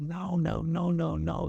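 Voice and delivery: put-on light Wiltshire accent, brushing off the complaint. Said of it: "He spoke in a sort of Wiltshire accent".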